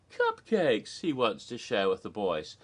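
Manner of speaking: ordinary delivery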